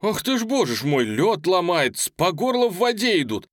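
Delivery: high, loud, agitated; stirred-up cry from a lookout; exclaiming. A narrator's reading. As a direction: gasp out what you see in astonishment. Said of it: "воскликнул".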